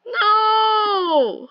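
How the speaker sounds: high, loud cry of dismay; anguished shout. Real, not acted. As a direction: yell out as you die. Dying in game.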